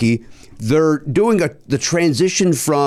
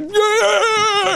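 high whooping yell of a fan into his jam; mock fan yell. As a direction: shout it like a big guy loving his song. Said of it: "he's like".

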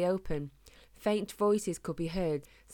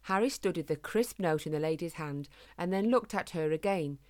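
ordinary delivery, nothing marked

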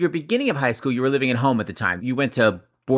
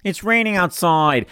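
put-on higher, slow weird-accent comeback; Mister Couture; 4 semitones higher, slower than usual. Host dodging boxers-or-briefs question, high weird-accented teacher imitation. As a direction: imitate the odd teacher, pitched up and drawn out. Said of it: "my imitation is amazing".

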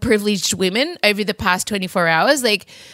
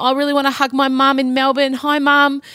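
lightly imitated New Zealand accent, raised pitch; 5 semitones higher than usual. Retelling an ad about missing mum, light New Zealand accent. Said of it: "in that beautiful New Zealand accent".

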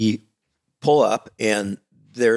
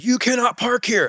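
mock yelling; 13 semitones higher than usual. Gate guard busting the driver, high mock yell. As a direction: scold like an officious gate guard, pitched way up. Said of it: "yell at me and bust me".

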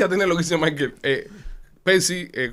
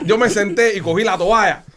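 ordinary delivery

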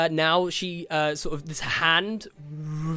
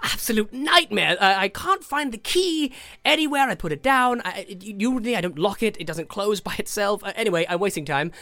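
flustered, higher-pitched rambling; Tasha; 4 semitones higher than usual. Acted. Harried contact speaking from the book, high and flustered. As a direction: stumble hurriedly through excuses, short on time. Game master voices Tasha.

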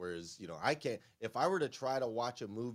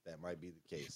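normal talking voice

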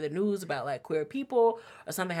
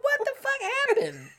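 baffled disbelief. Retold or imitated. Baffled inner reaction.

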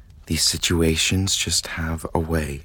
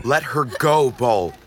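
raised, urgent order; higher, louder command; commanding shout; 6 semitones higher, 5 dB louder, slower than usual. Acted. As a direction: stop him now.

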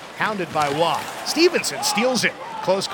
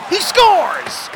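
goal call; 4 semitones higher, 4 dB louder than usual. Real, not acted.

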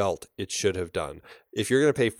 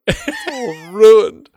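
mock despair; 13 semitones higher, 12 dB louder, much slower than usual. Mock despair over lost game.

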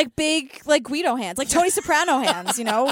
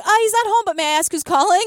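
high, louder imitation of a mom; mom answering phone; 5 semitones higher, 4 dB louder, faster than usual. Eddie's mom taking a stranger's call, imitated high polite voice. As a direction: chirp it politely, pitched up.

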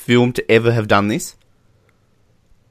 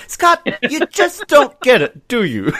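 Dr. Evil voice; 12 semitones higher, slower than usual. Retold or imitated. Voicing Dr. Evil.

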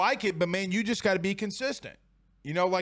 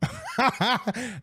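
laughing; 6 semitones higher than usual. Real, not acted. Host laughing at the clip.

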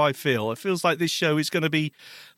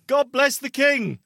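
mock-patriotic toast, higher and drawn out; mock patriotic British; 9 semitones higher, slower than usual. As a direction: proclaim it slowly, playing up the Britishness. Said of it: "Stop being British about this".

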